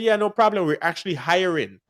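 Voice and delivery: ordinary talk, nothing marked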